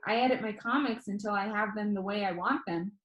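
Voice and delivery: ordinary delivery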